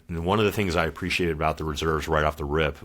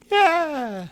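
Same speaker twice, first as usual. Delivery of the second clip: squeaky yeah; 4 dB louder than usual. Retold or imitated. Admitting being a Marine, comic.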